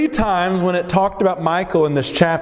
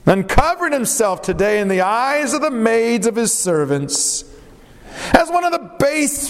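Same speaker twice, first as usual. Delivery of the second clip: sarcastic; 4 semitones higher than usual. Acted. Wife scorning the King of Israel as he comes home, sarcastic. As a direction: stretch the opening praise high and long, dripping sarcasm. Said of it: "I can almost hear the sarcasm".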